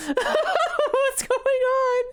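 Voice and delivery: very high, slow words through laughter; helpless laughter. Real, not acted. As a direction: squeal the question while cracking up. Cracking up at the absurdity.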